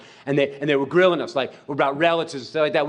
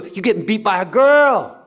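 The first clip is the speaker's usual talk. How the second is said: louder, higher teasing taunt; trash talk; 8 semitones higher, 8 dB louder than usual. Retold or imitated. Miss Pat taunting a boy on the court, teasing. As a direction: needle him like a cocky rival. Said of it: "She talked smack".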